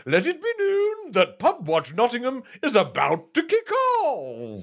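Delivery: booming high town crier call. Acted. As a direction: proclaim it like a medieval crier. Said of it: "reenact it with you two".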